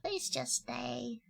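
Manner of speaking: slow soft cute plea. Pretend. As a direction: plead sweetly, drawn out. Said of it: "I'm asking nicely in a cute voice".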